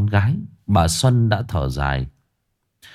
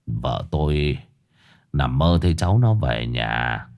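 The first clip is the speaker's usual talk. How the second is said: put-on lower, softer, stammering old man; fearful old man; 6 semitones lower, 3 dB quieter than usual. Bích tells Xuân her daughter is dead, low and fearful. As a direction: let the bad news stick in your throat. Bích delivers grim news.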